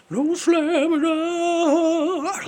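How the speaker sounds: high, slow falsetto sung line. Shown as a demonstration. Falsetto demo of a singer's style. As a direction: float it up in falsetto, drawn out. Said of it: "I have to do falsetto".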